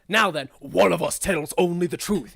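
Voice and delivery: loud, solemn riddle intonation; riddle guardian. Acted. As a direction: declare the riddle grandly.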